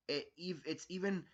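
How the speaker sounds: ordinary delivery, nothing marked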